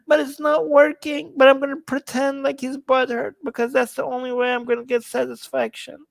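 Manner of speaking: high, small mock-pleading voice; whiny upset troll. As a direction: play the troll as a helpless, wounded whiner. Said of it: "he's like".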